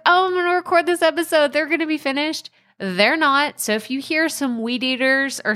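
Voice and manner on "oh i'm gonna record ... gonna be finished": mock naive self. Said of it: "I was like".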